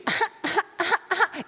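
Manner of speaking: high-pitched put-on giggle; mock giggle. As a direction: imitate a selfie-taker's high little laugh. Mimicking a cute selfie poster.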